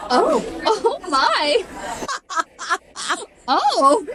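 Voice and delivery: high, slow, scandalized oh my; a prim lady hearing something shocking, drawn out; imitating the sister-in-law's mom gasping at noises from a stall; shocked gasp. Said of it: "I hear my sister-in-law's mom go".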